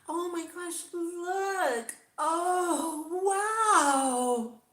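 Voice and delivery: very high, loud, sugary influencer squeal; fake bubbly vlogger voice. Shown as a demonstration. Vlogger demoing the fake voice she refuses to use, squealing high. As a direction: put on a phony excited YouTuber voice. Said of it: "let me try and do it".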